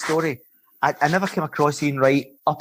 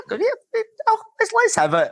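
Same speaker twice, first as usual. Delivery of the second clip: squeaky high-pitched mock taunt, daring them on; hammed-up falsetto goading of the opposing players. Player taunting rivals off the pitch in mock falsetto. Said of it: "And I says".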